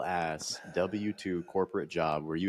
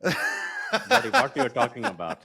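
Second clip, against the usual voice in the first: put-on Indian accent; 14 semitones higher, 4 dB louder, faster than usual. Mock Indian accent banter.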